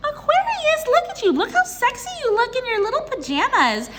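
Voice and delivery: high-pitched gushing squeal; really amped up. Real, not acted. Tarot reader cheering on Aquarius, really amped up. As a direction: squeal it like a thrilled best friend. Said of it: "I know I'm getting really amped up".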